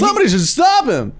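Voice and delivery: very high stage-comic exclamation; mock stand-up comic. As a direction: play a hacky comic, voice shooting up. Continues the mock stand-up routine.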